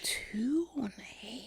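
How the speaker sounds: whispered aside; breathy whispered joke. Real, not acted. Joking aside.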